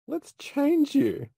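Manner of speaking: high, drawn-out mock meditation voice; mock meditation guide. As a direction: imitate a meditation guide, slow and high-pitched. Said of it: "a person on a meditation saying".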